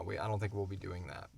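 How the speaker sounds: normal talking voice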